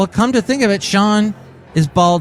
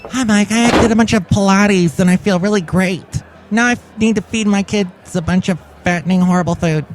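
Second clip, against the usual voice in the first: higher-pitched ad-actress character; perky commercial mom; 5 semitones higher than usual. Acted. Commercial mom telling host about Pilates, perky. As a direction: chatter brightly like a mom in an advert. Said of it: "one of the actresses".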